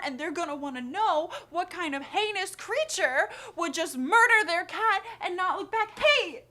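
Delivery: hammed-up frantic spiral, climbing in pitch and volume; mounting panic. Acted dread building to outburst.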